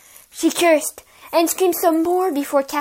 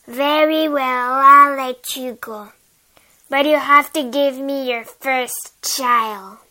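witch voice; 7 dB louder, slower than usual. Acted.

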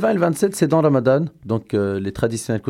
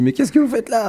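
mock guard challenge; 12 semitones higher than usual. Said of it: "on nous dit".

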